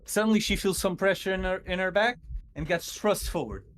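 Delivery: ordinary talk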